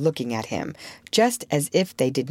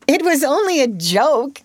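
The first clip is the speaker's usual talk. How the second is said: much higher, loud roar; 10 semitones higher, 5 dB louder than usual. A narrator's reading. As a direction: bellow in defensive rage. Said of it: "he roared".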